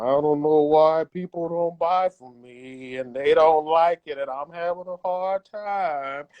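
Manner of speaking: slow, whining mock complaint; whiny complaining. Mocking people who complain online that nobody buys, slow and whiny. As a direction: drag it out like a sulking creator.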